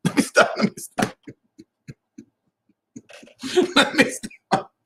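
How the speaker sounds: helpless real laughter breaking up the words; words squeezed out breathlessly through laughter; laughing. Real, not acted. Cracks himself up at own joke.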